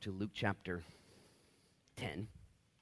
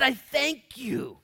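very slow, higher, louder thanks; exultant prayer; 11 dB louder, much slower than usual. Acted. Jesus praying to the Father, slow loud exultant thanks. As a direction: stretch out the thanks in joy.

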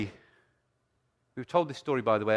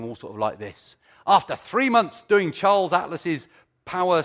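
preaching; 4 semitones higher, 7 dB louder than usual. Acted. Preaching at full force.

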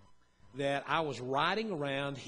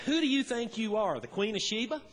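louder, higher mock-scolding parent; a playful parental put-down, a little raised; mother-in-law teasing a little girl with a raised scold; 6 semitones higher, 5 dB louder than usual. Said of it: "Her mother said to".

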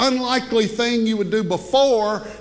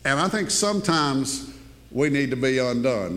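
ordinary talk

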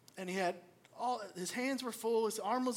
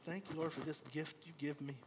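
mock grateful prayer; 6 semitones lower, 10 dB quieter than usual. Sarcastic prayer after the tantrum.

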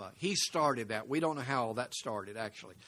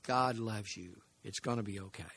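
softer, tender reassurance; soft comfort; 4 semitones lower, 6 dB quieter than usual. Shown as a demonstration. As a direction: hold someone and soothe them gently. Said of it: "and say".

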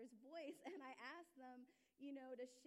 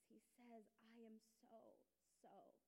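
soft quoted whisper-like tenderness; 9 dB quieter, slower than usual. Retold or imitated. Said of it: "And she says".

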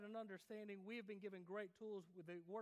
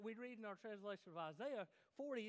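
ordinary delivery

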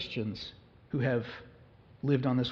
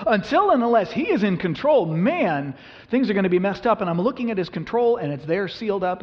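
preaching at full force; 5 semitones higher, 7 dB louder than usual. Acted. Pastor urges his church toward hope in God, passionately preaching. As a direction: drive it home like a fervent preacher.

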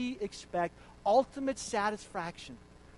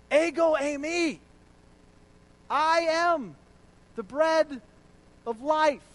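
much louder, higher shout of Ego eimi; 8 semitones higher, 12 dB louder, slower than usual. Retold or imitated. Jesus shouting his emphatic claim to the crowd. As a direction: cry it out with full force. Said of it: "it almost sounds like Jesus is shouting".

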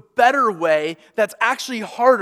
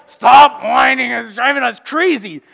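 mock exasperated parents; 3 semitones higher, 8 dB louder than usual. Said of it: "And we're like".

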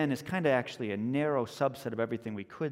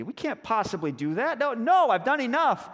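high protest building to loud; refuse, escalating to an outburst; exasperated refusal; 10 semitones higher, 5 dB louder than usual. Acted. Husband refuses wife's mad demand.

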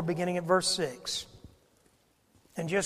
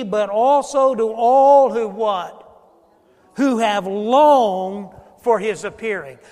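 preaching; 3 semitones higher, 9 dB louder, slower than usual. Acted. Slow full-force scripture preaching.

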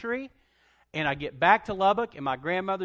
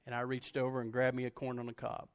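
usual speaking voice

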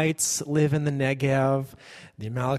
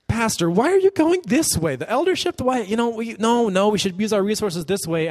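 indignant church member; 7 semitones higher than usual. Acted. Church member objecting, acted.

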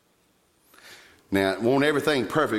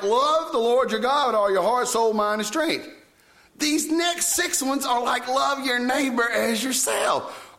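high, slow, lit-up reading of the verses; excited retelling; 12 semitones higher, slower than usual. Retold or imitated. Young believer reading the commandments, lightly excited. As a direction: read it like a thrilling discovery.

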